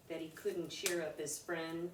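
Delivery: plain reading voice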